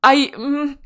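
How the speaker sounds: ordinary talk, nothing marked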